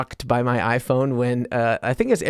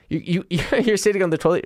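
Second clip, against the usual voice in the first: ordinary talk, nothing marked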